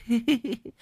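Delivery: high silly giggle; simple giggle. A narrator's reading. Madwoman giggles at the old woman, high and silly. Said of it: "nó cười cười".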